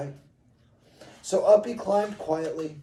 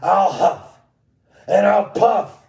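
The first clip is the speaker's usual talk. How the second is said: loud, higher, punchy wrestler threat; Hulk Hogan; 6 semitones higher, 10 dB louder, slower than usual. Acted. Wolf threatening the brick house, Hulk Hogan roar. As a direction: bellow it like a wrestling promo. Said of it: "in his best Hulk Hogan impression".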